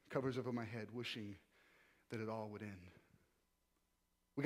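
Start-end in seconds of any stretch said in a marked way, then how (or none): 0.1-2.9 hopeless hush. Real, not acted. Lying in bed hopeless.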